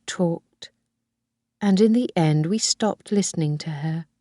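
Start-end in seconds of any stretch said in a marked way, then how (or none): none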